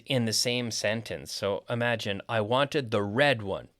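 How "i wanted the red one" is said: RED stressed over the other words. Shown as a demonstration. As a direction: hit RED and fall away after it. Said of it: "we can stress different words".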